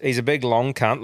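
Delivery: normal talking voice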